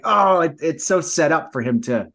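usual speaking voice

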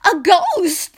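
high, loud cry of fright; sudden shriek of alarm; terrified scream. A narrator's reading. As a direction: scream at the sight of a ghost. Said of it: "they screamed".

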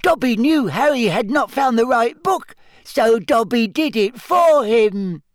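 high squeaky elf voice; squeaky house-elf. A narrator's reading. Dobby voice.

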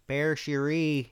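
"Pere Sherry" drawn out slowly, loud and high, broad Michigan vowels; Michigan accent. Shown as a demonstration. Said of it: "really Michiganese".

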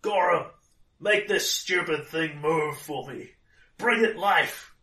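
loud, high call to her god; commanding prayer. Acted. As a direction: demand it of the god. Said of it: "she says".

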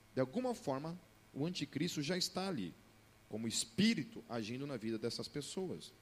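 usual speaking voice

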